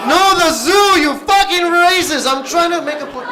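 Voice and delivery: high, loud, laughing fake rage; mock outrage. Comic yelling at an audience member, loud and high, laughing. Angry at heckler answer.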